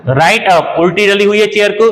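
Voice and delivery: normal talking voice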